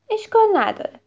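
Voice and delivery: high, bright no-problem; lightly voiced child; cheery reassurance. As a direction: brush it off cheerfully. Miko reassures again.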